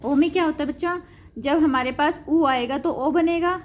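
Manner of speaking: normal talking voice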